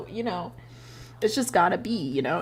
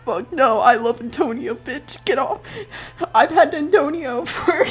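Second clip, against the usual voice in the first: higher, louder, laughing through it; heated protest; 9 semitones higher, 3 dB louder than usual. Retold or imitated. Barry refusing to kill Antonio, worked-up and laughing. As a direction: defend Antonio in a worked-up outburst. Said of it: "Barry's like".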